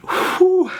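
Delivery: high exhale to steady the tears; tearful whew. Real, not acted. Owner talking about his dead pet gives a tearful whew. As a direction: blow out to hold it together.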